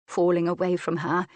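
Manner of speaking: ordinary delivery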